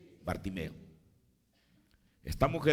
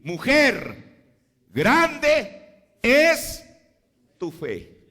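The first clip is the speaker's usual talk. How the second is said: much higher and louder, soaring on grande; exultant proclamation; 9 semitones higher, 11 dB louder, much slower than usual. Acted. Preacher proclaiming Jesus' praise of the gentile woman, exultant and loud. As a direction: proclaim it like a triumph.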